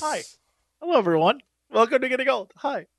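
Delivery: cheery mock TV host; show host intro. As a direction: announce it like a show opener.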